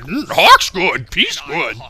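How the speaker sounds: simple-minded character voice. Acted. Character repeating his only lines, slow dopey voice. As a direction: drag it out like a dim-witted creature. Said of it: "come right out and do that".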